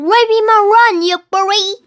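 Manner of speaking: loud high angry cry; fierce yell at the pirate; defiant shout. Acted. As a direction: shout down the bully. Girl defies pirate.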